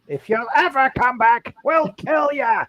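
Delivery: Lion King hyena. Retold or imitated. Co-host teasing a viewer on camera, a mock hyena threat. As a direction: drawl it out like a cartoon hyena. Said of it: "remind me of the hyenas in Lion King".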